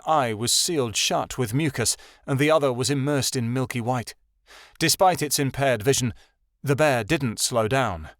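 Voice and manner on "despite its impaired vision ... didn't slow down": ordinary delivery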